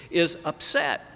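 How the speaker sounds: ordinary delivery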